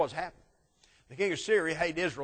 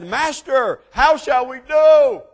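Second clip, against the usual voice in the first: loud, high, drawn-out frightened plea; panicked cry; 10 semitones higher, 16 dB louder, much slower than usual. Retold or imitated. Servant panicking to his master about the army. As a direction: cry out for help in terror.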